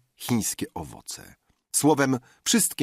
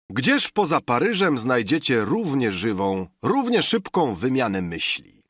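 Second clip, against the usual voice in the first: usual speaking voice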